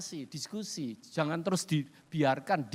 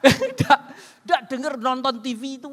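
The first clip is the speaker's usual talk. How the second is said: higher, louder laughing admission; 6 semitones higher, 9 dB louder than usual. Real, not acted. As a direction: laugh through the confession. Laughing at himself.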